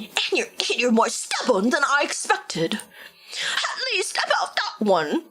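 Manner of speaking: slow, whispered snooty British voice; slow hushed snooty British read; stuck-up British voice. Acted. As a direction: sneer it like a posh snob. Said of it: "Sounds so fucking stuck up".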